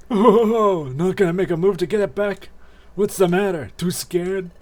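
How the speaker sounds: high, loud mocking villain voice; taunting bully. Villain to timid victim over stolen fragment, high mocking taunt.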